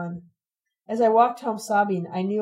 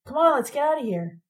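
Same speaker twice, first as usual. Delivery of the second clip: high, hurried urgency; urgent; 6 semitones higher than usual. A narrator's reading. Ron hurries the girls after the crash, urgent. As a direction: push them to move now. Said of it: "and said with urgency".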